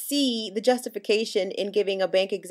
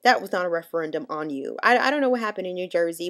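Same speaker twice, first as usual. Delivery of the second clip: usual speaking voice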